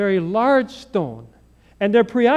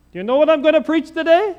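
higher, louder, faster challenge to the crowd; teasing call-out; 9 semitones higher, 6 dB louder, faster than usual. Retold or imitated. Seminarian challenging the chapel congregation, loud and teasing. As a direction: fling the question out at them.

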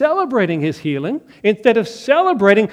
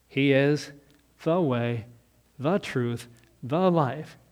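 soft, each "the" stressed; quiet emphatic "the"; 5 semitones lower, 6 dB quieter, slower than usual. Shown as a demonstration. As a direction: lean on every "the", quietly. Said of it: "definite article the".

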